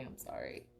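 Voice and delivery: a whispered sorry to herself; whispered apology. Real, not acted. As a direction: mutter it under your breath, embarrassed. Flustered apology as stream freezes.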